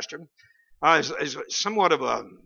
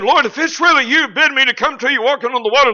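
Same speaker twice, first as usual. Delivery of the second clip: Peter's raised, eager plea, a bit louder; 7 semitones higher, 6 dB louder than usual. Retold or imitated. Preacher retells Peter begging Jesus, eager plea. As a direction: call out eagerly to the Lord. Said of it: "you know what Peter said".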